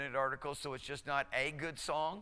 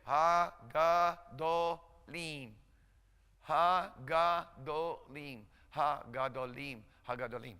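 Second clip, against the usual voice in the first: slow to faster; slower than usual. Shown as a demonstration. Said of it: "just work your way up".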